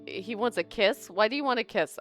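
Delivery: normal talking voice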